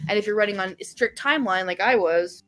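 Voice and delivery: ordinary talk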